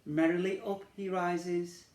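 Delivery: plain reading voice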